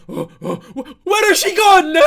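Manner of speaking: mock Italian host parent, shrieking in panic; hammed-up Italian accent, yelling in alarm. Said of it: "I can't do that accent for the whole video".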